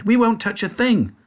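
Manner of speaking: high eager promise; eager children. A narrator's reading. Children answer Wonka, high and eager. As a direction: rush to agree. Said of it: "the children cried".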